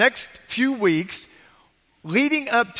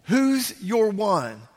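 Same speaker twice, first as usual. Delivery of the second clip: slow title; much slower than usual. Shown as a demonstration. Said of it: "it's being called".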